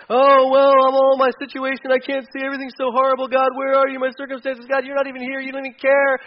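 high, whiny pretend complaint to God; hammed-up self-pity, pitched high; mock wailing lament. Pastor mimics a self-pitying believer, whiny and high. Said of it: "before you start talking about".

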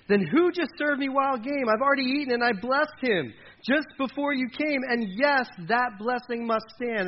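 high, loud dramatic reading; agitated distress. Acted. Father learning he blessed the wrong son, distraught. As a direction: cry it out as a shaken father. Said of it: "began to tremble uncontrollably and said".

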